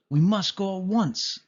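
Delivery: urgent cry. A narrator's reading. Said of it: "cried Perdita".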